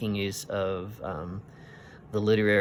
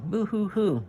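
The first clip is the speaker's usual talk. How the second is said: high wailing boo-hoo, much higher; mock child crying; 12 semitones higher, 4 dB louder than usual. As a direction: wail like a little girl in tears. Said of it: "That sounds like a child crying".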